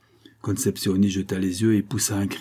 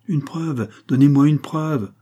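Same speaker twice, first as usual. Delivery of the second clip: high, louder demand for proof; insistent; 6 semitones higher, 6 dB louder, slower than usual. A narrator's reading. Concepcion demands proof from the countess, insistent. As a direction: press hard, voice raised on the repeat. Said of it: "insista Concepcion".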